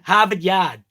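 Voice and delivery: "Harvard Yard" as Bostonian Hahvid Yahd; no R's in "Harvard Yard", Boston style; Boston accent. Shown as a demonstration. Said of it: "There's an R in Harvard".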